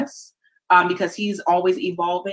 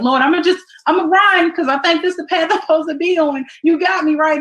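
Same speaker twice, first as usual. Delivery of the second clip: high, loud, eager plea to the Lord; excited prayer; 9 semitones higher, 5 dB louder than usual. Retold or imitated. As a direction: call out to the Lord, rushing. Person praying while running.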